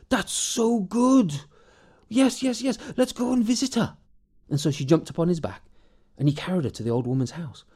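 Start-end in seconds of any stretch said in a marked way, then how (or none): 0.1-4.0 delighted simple man. Acted. Morgan praises his clever wife, high and delighted. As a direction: stretch out the joy, pitched up.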